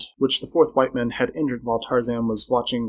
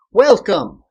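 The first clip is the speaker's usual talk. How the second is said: high, loud, hearty welcome; cheery greeting; 8 semitones higher, 10 dB louder than usual. A narrator's reading. Man greeting Tarzan with feigned friendliness, cheery. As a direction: greet heartily with an audible smile. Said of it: "a pleasant smile and a cheery word".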